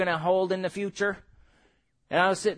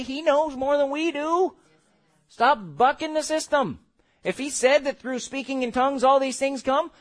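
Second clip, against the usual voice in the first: ordinary delivery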